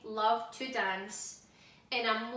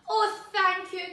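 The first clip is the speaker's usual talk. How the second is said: higher, louder glad cry; relieved cry; 6 semitones higher, 9 dB louder than usual. A narrator's reading. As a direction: burst out with relief. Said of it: "cried Meg".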